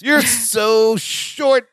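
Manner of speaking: very slow, stretched jeer; slow mocking jab; drawn-out taunt. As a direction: drag the words out mockingly. [name] taunting.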